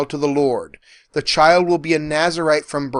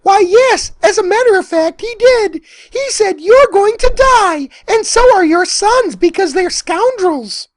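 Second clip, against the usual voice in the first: put-on very high, loud, chirpy kid voice; little boy Samuel; 7 dB louder than usual. Little Samuel tells the high priest God's message, high squeaky voice. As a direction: deliver it brightly as a small child. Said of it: "little Samuel says".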